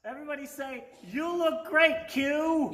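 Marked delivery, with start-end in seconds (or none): 1.1-2.7 high, loud line for the crowd to echo; calling out. Real, not acted. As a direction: call it out so the whole room repeats. Leading crowd chant to child.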